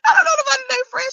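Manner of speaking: lightly voiced mother. Vlogger quoting her mother, quick high denial. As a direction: brush it off fast.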